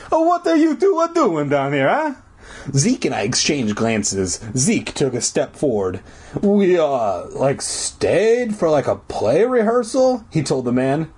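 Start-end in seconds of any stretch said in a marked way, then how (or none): 0.1-2.1 very high, hammed-up Italian janitor; bad Italian accent. A narrator's reading. Janitor questions two kids, exaggerated Italian accent. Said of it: "make it sound like he was Italian". 6.4-10.2 halting, rising into a high question; stall, then offer it unsurely; hesitant excuse. A narrator's reading. Zeke offers the janitor an excuse, hesitant. Boy making up nervous excuse.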